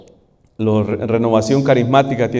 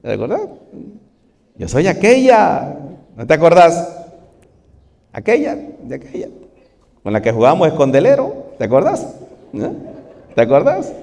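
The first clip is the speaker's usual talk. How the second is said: higher, teasing woman's voice; malicious flirt; 4 semitones higher, faster than usual. Acted. Old flame teasing the bishop Augustine, higher and sly. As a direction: coax him to remember, slyly. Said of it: "toda maliciosa".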